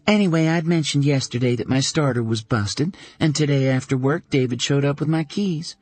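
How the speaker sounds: Caroline, a little flustered; lightly voiced Caroline. A narrator's reading. Caroline telling Dana how David fixed her car, lightly voiced.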